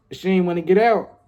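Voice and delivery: normal talking voice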